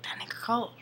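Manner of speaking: hushed whisper. Retold or imitated. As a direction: whisper it in awe. Whispered realization.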